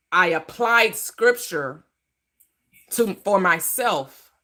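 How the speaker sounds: very slow, spelled-out words; slow it down to make a point; slow and deliberate. Real, not acted. Repeating her answer to the caller.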